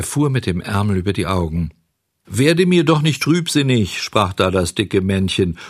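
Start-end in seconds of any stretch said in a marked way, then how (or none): 2.3-4.0 cajoling coachman. A narrator's reading. Coachman cajoles sad boy.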